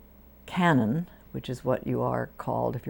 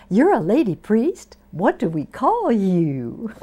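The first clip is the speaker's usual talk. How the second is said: high, loud Texas drawl, asking; a Texas church lady asking what to call her; Texas accent; 7 semitones higher, 8 dB louder, slower than usual. Retold or imitated. Texas women greeting the new lady priest in a Texas accent. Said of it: "That was the Texas accent".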